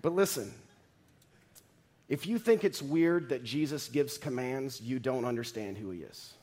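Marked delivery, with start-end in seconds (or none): none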